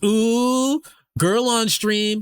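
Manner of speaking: high teasing ooh, slowed right down; excited chat squeal. Retold or imitated. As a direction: squeal it like a thrilled chatter. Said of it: "Pillow goes".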